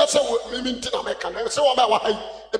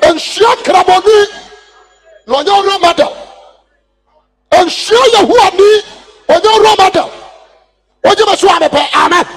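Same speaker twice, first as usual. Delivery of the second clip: full-force preaching; 6 semitones higher, 10 dB louder than usual. Acted. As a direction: thunder the lesson at the congregation.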